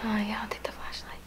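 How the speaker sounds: hushed, scared whisper; nervous night vlog whisper. Real, not acted. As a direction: whisper like someone might hear. Whispering with flashlight.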